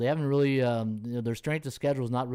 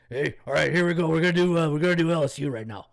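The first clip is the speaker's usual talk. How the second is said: hyped-up coach voice; 3 semitones higher, 6 dB louder, faster than usual. Retold or imitated. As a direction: pump it up like Coach O. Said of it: "my Coach O voice".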